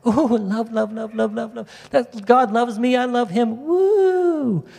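mock gushing feeler ending in a high woo. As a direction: swoon like a sentimental believer. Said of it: "You feelers out there ... you love the love part".

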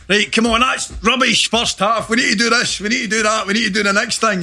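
high, keen rallying cry to the team; hammed-up captain firing up the lads; mock rallying pep talk. Footballer rousing teammates at half-time, high and eager. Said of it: "I'm not saying".

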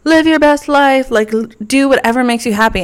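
a hint of a gushing influencer; upbeat life coach. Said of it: "one of those people that is like".

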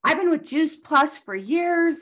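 high, slow, put-on voice of a chirpy caller; a hammed-up sweet caller; mock proud caller. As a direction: ham up the stranger bragging about her company. Mimicking the caller's proud claim.

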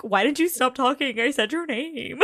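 mock exasperated outburst. [name] protests that Mon stopped talking, mock exasperated. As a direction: play up the frustration.

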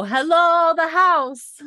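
loud, high, long-held holler; hailing a house. Shown as a demonstration. Showing how visitors announce themselves, hailing loudly. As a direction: call it out long and high from a distance. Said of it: "you don't walk up and knock".

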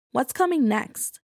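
usual speaking voice

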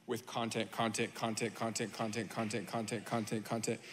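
low, soft monotone chant; droning repetition. Shown as a demonstration. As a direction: repeat it like an endless soft drone. Droning list of endless content.

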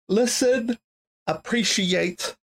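words stretched by a yawn. Real, not acted. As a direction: talk through a yawn. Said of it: "I'm sorry that I'm yawning so much".

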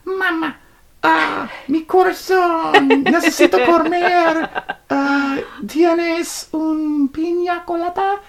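very high, drawn-out Spanish speech; mock Spanish. Storyteller mocks speaking a whole Spanish sentence, exaggerated Spanish accent. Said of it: "if you were like".

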